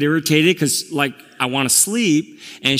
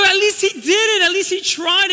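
put-on high-pitched, exasperated defense of a child; re-enacted indignant pleading; retold angry protest. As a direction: argue back in a raised, high voice. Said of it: "I just got so angry".

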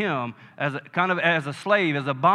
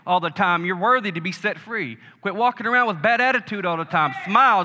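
louder, higher preaching push; sermon exhortation building up; fervent exhortation; 3 semitones higher, 4 dB louder than usual. Acted. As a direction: drive it home to the church. Exhorting the congregation.

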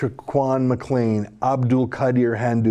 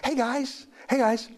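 high-pitched, softened mock-advisor voice; mock police advice; 12 semitones higher, 4 dB quieter than usual. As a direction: put on a high, sugary safety-announcement voice. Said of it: "Police have offered these safety tips".

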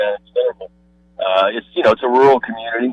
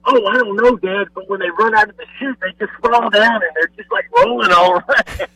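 put-on higher, wide-eyed boy voice; lightly voiced excited boy; excited son; 9 semitones higher than usual. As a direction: play the son describing the calves rolling. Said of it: "And he said".

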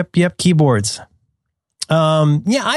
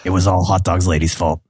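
low terse deadpan read; grim clipped vigilante diary; Rorschach voice; 6 semitones lower than usual. Retold or imitated. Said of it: "reading these as Rorschach".

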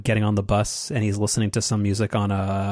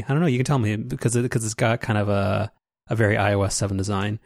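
ordinary talk

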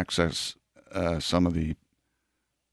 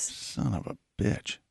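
muttered curse; 10 dB quieter, slower than usual. Real, not acted. Mock outrage at toy motive.

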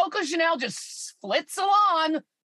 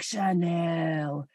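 celebrity ad purr; 6 semitones lower, 4 dB quieter than usual. Retold or imitated. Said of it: "Julia Roberts are like".

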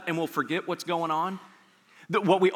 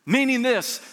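ordinary talk

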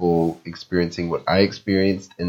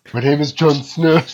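mock Jon Snow; 7 semitones higher, 5 dB louder than usual. Kit Harington impression.

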